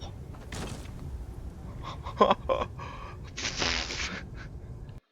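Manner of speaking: long unvoiced laugh at himself; soft embarrassed laughter; breathy laugh. Real, not acted. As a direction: laugh off the blunder under your breath. Laughing at his own fall.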